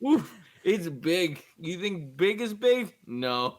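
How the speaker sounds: drawn-out slow cosmic awe; slowly, as if space is huge; slow mock awe. Mock grand talk about space.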